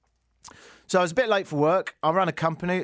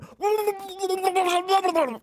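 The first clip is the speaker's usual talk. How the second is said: high wailing scream; screaming into water. Shown as a demonstration. Host acting out screaming into a bath, high drawn-out wail. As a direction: let out a long anguished howl. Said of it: "put my head in it and go".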